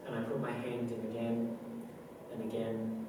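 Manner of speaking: sinking to a near-silent trail-off; haunted, fading. Acted. Confessing to burning the hand repeatedly, fading quietly.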